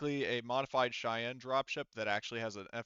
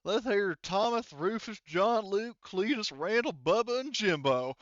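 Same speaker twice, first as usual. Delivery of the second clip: loud, higher rural Texas voice rattling off names; Texas hillbilly voice; 9 semitones higher, 7 dB louder, slower than usual. Acted. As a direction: proudly introduce the whole clan, country style. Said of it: "He said".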